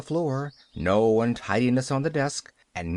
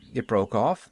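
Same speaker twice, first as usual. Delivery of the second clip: ordinary delivery, nothing marked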